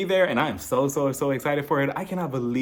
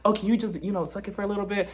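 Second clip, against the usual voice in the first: flirty ask; 6 semitones higher, 4 dB quieter than usual. Retold or imitated. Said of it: "So you try to".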